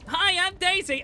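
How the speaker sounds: high, loud squeaky princess voice; Daisy voice. Retold or imitated. Imitating Daisy's Mario Kart voice.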